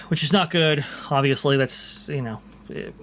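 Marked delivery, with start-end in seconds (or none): none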